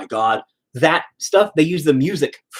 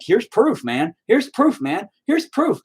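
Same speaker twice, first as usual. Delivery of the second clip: each "Here's proof" pitched higher; insistent repetition; 7 semitones higher, slower than usual. Retold or imitated. Insisting to Godzilla groups, rising insistence. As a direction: keep pushing the proof, rising each time. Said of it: "I'm like".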